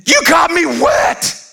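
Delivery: high, slow mock scream of an angry little boy; hammed-up toddler yell; child's mad scream. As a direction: squeal it out like a furious kid. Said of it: "He's screaming mad".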